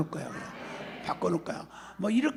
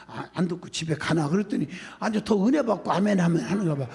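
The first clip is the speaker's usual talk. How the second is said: ordinary talk, nothing marked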